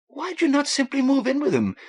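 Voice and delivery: curious Irish-tinged woman. A narrator's reading. Kathleen asks.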